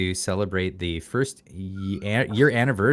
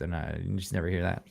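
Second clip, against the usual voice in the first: ordinary talk, nothing marked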